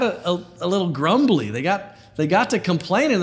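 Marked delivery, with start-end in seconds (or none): none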